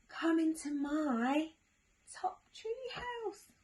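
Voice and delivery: high, slow owl voice; owl character. Acted. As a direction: draw out a high-pitched owl's invitation. Owl character invites mouse.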